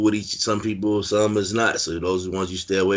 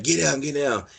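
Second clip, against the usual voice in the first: imitated urgent warning; 8 semitones higher than usual. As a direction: call out a retold warning, pitch jumping up. Acting out everybody's urgent warning.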